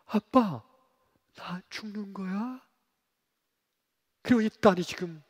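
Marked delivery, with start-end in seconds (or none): 0.1-2.6 slow, breathy scared question; a little girl afraid to ask; frightened child. Retold or imitated. Frightened daughter asks father. (겁먹은 딸이 아빠에게 묻는)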